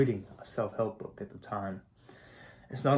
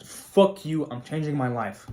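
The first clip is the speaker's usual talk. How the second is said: higher and louder, defiant shout; defiant outburst; 5 semitones higher, 8 dB louder, slower than usual. Retold or imitated. Vlogger voicing a reader fired up to change, mock defiant shout. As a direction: bark it like you're done with everyone. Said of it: "This is the kind of".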